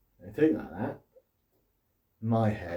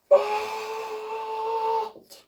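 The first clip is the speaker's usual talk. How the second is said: "Fault!" yelled very high and loud, held long; a huge, stretched-out shout of "Fault!"; really loud umpire call; 4 dB louder than usual. Retold or imitated. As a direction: bellow "Fault!" like an over-keen tennis umpire. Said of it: "Like, really loud".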